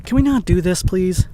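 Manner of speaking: loud, high and fed up. Acted. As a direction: beg her to stop, loudly. Exasperated in the fight.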